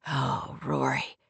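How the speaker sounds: low, hushed moan of a lover; soft moan. A narrator's reading. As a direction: moan it deep and very softly. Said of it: "he moaned".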